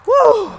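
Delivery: loud, high whoop of relief at the top; triumphant woo. Real, not acted. Hiker reaching the top of the steep hill, whooping. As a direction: let out a big woo after the climb.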